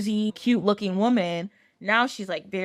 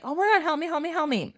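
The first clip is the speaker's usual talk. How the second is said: mock scream; 6 semitones higher, much faster than usual. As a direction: plead in a pitched-up, half-hearted scream. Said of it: "she starts screaming".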